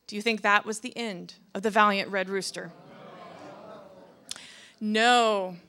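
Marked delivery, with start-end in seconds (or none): none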